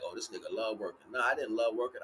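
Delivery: usual speaking voice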